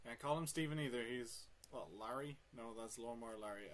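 normal talking voice

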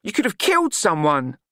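telling Nutt off firmly; scolding Glenda. A narrator's reading.

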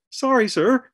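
high, loud startled yelp. A narrator's reading. Rollo apologizes to the colonel, fearful high yelp. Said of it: "he yelped".

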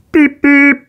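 high loud beep beep; imitated engine horn. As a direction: toot like a train.

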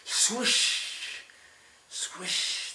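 airy whispered swish of wings; whispered swoosh. Acted. Grandpa whispers the birds swooping over the river. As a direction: breathe it out like a bird gliding past. Bird swoop sound words.

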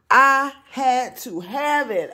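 high, slow, drawn out with delight; gushing excited. Real, not acted. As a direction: savor every word of wanting it. Thrift store find excitement.

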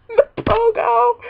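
slow high wail; anguished cry. Real, not acted. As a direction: wail the name in mock grief. Character crashes, cries out.

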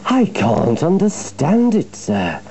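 high-pitched voice of put-on bewilderment; lightly voiced sly engine playing innocent; Diesel feigning innocence. A narrator's reading.

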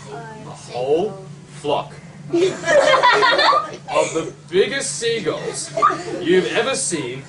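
slow, drawn-out words dissolving into loud laughter; laughing. Real, not acted. Telling the child about the seagull flock, laughing. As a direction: stretch it out while cracking up. Whole flock poops on sandcastle.

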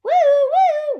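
loud, very high siren woo-woo; imitated siren, loud and high; siren wail. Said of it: "went a siren".

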